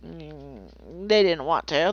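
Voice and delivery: ordinary delivery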